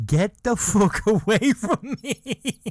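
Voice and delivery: high, slow, cracking up mid-line; can't keep a straight face while quoting him; laughing through the quote. Retold or imitated. Celebrity finally snapping, told laughing.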